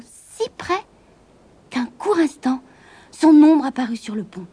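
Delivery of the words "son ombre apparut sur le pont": ordinary delivery, nothing marked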